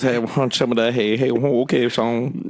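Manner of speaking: Boomhauer-like run-together mumble; John Bull. Retold or imitated. Friend imitating how an old camper talked, Boomhauer-style mumble. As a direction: garble it like Boomhauer from King of the Hill. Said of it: "He talked like this".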